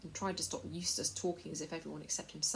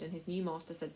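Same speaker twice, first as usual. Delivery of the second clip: ordinary delivery, nothing marked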